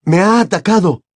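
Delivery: high, loud accusing voice; aggrieved Krum. A narrator's reading. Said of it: "dijo Krum".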